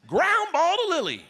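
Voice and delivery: high, loud coach calling plays. Retold or imitated. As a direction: bark it out like a peppy softball coach. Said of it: "all in position".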